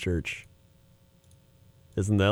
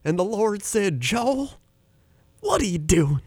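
Joel Osteen impression; 8 semitones higher than usual. Mimicking Joel Osteen to a friend, high upbeat preacher voice. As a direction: sell it like a beaming TV preacher. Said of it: "He's like".

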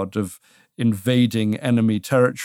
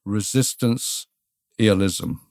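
Resistance-ialism spelled out slowly, each syllable weighed; slow deliberate pronouncement; much slower than usual. Shown as a demonstration. Host asks for the term, guest pronounces it slowly. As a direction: say Resistance-ialism slowly like teaching a new word. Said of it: "And the word is?".

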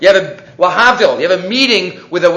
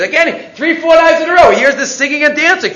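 excited build; 6 semitones higher than usual. A narrator's reading. Storyteller builds the king's return.

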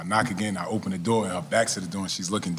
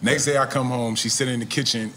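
ordinary talk

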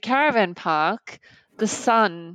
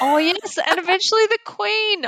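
high, bright-eyed delighted echo of the line; 13 semitones higher, 5 dB louder than usual. Real, not acted. Co-host echoes the queen line with delight. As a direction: repeat it with real delight, much higher.

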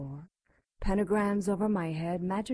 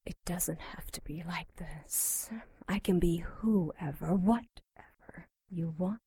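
breathy tempting whisper; seductive whisper. Acted. Demon seduces the cleric.

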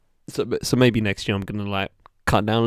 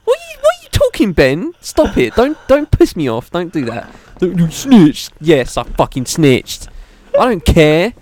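high, loud, fast indignant rant; hammed-up outrage; 7 semitones higher, 6 dB louder than usual. Ranting at his friend Ben, high and loud, in mock anger. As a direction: play up the fury for laughs.

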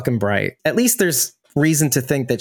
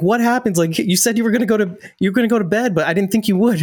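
flustered dad; 6 semitones higher than usual. Retold or imitated. Retold dad reacting to child's fall.

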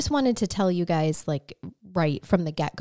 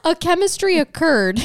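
put-on Southern accent; 9 semitones higher, 4 dB louder than usual. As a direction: declare it in a playful Southern accent. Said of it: "butcher your accents now".

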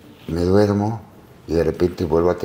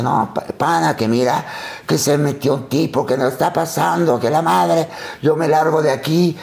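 higher, rattled and hurried; frightened rant; 7 semitones higher than usual. Retold or imitated. Brother telling the narrator he's leaving, rattled. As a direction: blurt it out, still shaken. The brother explaining, still scared.

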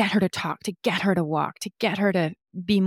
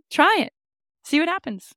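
mock dare; 10 semitones higher, 5 dB louder than usual. Mock threat to the spirits.

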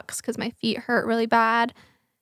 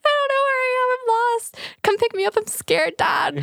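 very high, hammed-up panicked wail; imitated tearful plea, pitched way up; mock crying; 13 semitones higher than usual. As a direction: wail it like a lost child calling for a parent.

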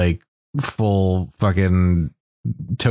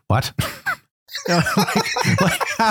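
squeaky, high laughing speech; words breaking up into high giggles; laughing hard. Real, not acted. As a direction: try to talk through real laughter. Cracking up while asking.

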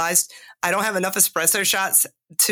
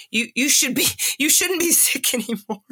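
pitched up, laughing through the scolding; mock-scold herself while giggling; 6 semitones higher than usual. Host mocking her own inner critic, laughing. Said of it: "telling myself".

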